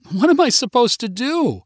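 high-pitched, helpless wail, acted; distressed cry. A narrator's reading. Customer desperate for her lost ring, distressed cry. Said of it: "cried Jessica".

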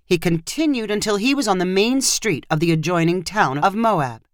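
ordinary delivery, nothing marked

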